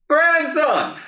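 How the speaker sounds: high, drawn-out formal greeting; grandpa's voice. Retold or imitated. Grandpa greeting his grandson on the phone, high and drawn out. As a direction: call him out slowly like a preacher. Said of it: "That's how he talked".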